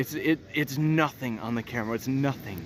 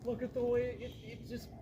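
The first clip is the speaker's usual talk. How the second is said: awed hush; 9 semitones higher, 15 dB quieter than usual. Real, not acted.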